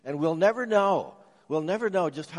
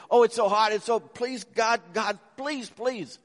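high, breathless mock panic; desperate pleading; 7 semitones higher, 4 dB louder than usual. Reporter running from the volcano, pleading to God in high mock panic. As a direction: echo the reporter's frantic begging, pitched up. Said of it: "then there'd be sobbing and panting".

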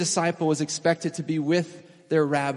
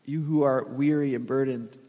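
gentle invitation; 2 semitones lower, 3 dB quieter than usual. Acted. Preacher voicing Jesus to the weary, soft and tender. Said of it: "Jesus is saying".

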